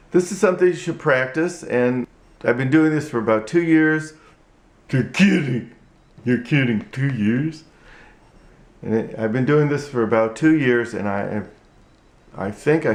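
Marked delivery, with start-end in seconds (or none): 4.9-7.6 ventriloquist dummy voice; puppet voice. Acted. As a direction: speak as the puppet. Puppet is amazed.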